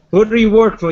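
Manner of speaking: demanding shout. Acted. In-character demand at NPC.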